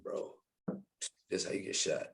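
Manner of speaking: hushed, breathless whisper to his friend; tense retold whisper at a traffic stop. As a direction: whisper it like you're scared of being heard.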